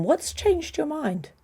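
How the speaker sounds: ordinary talk, nothing marked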